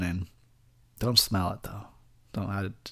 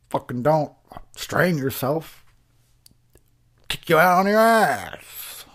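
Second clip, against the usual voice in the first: acting like Darnell; 4 semitones higher, slower than usual. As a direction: drag it out like a rude grump. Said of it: "I would be acting like Darnell".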